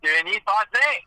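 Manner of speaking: Denny Potvin said French, duh-NEE pot-VAY; French pronunciation. Shown as a demonstration. Announcer showing that he's French, Denny Potvin in French pronunciation. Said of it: "he's French, like, he's".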